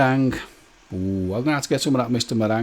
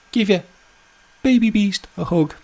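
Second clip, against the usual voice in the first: put-on cutesy Baby Beast; 8 semitones higher than usual.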